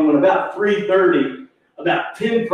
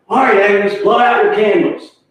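louder, higher call to action; brisk urging; 6 semitones higher, 5 dB louder than usual. Retold or imitated. Harry urges stunned Agnes on, louder raised urging. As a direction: imitate a host chivvying the guest of honour.

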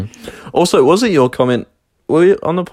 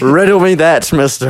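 mock challenge; 5 semitones higher, 3 dB louder than usual.

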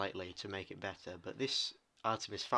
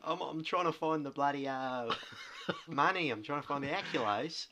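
put-on Irish accent; 4 semitones higher, 3 dB louder than usual. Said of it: "Is that your Irish accent?".

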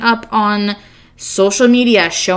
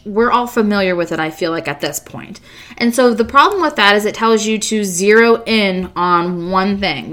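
usual speaking voice

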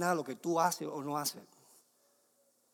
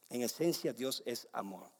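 ordinary talk

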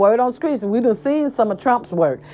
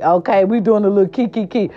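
usual speaking voice

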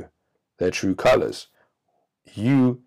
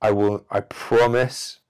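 promising scorpion; 4 semitones higher than usual. Acted. Scorpion promising the frog it won't sting, high and eager. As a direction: swear it earnestly, overdoing it. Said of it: "the scorpion says".

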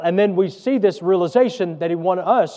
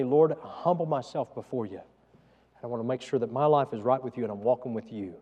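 soft humble prayer; 4 semitones lower, 6 dB quieter than usual. Pretend.

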